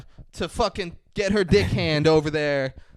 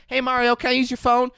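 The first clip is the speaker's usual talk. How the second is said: mock hooker voice; 8 semitones higher, faster than usual. Hooker asking restaurant owner.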